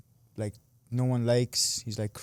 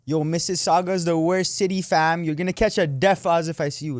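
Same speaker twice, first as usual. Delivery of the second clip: put-on loud, higher-pitched Toronto accent; hyped-up Toronto slang accent, pitched up; wild Toronto accent; 6 semitones higher, 5 dB louder than usual. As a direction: imitate a kid going crazy in Toronto accent. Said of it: "going crazy with the Toronto accent".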